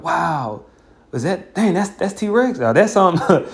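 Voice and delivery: high, wide-eyed zoo-visitor wonder; gawking amazement. Retold or imitated. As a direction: gape like a tourist at a caged beast. Nations gawking at caged Edomites.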